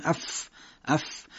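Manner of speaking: اف with a soft airy puff; blown f. Shown as a demonstration. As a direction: blow air through the f.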